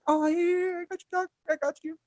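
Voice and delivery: very high, small squeaky voice; mock eager compliance. Fake Jedi giving in at gunpoint, high-pitched compliance. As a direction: imitate a cowed guy agreeing, way up high.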